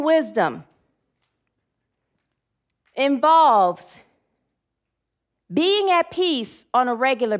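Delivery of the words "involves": normal talking voice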